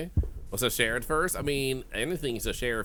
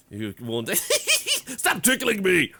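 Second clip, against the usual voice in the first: being tickled; 9 semitones higher, 7 dB louder, much slower than usual. Pretend. Pretend being tickled by game character.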